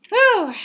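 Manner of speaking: loud high relieved whew; emotional exhale. Real, not acted. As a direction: blow out the emotion in one big breath. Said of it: "The emotional, emotional one".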